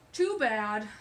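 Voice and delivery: loud, curt brush-off; dismissive stink bug. Acted. Stink bug refusing the bunnies, loud and curt. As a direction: slam the door on them. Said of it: "said the stink bug".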